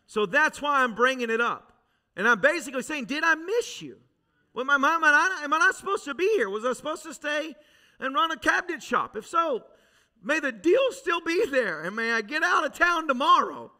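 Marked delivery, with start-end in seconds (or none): 3.1-13.7 raised pitch, pleading and pressing; high, urgent retold prayer; desperate plea to God.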